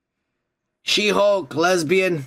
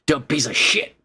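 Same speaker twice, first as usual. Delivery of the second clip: normal talking voice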